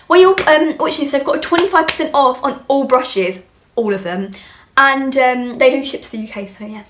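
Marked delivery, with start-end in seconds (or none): none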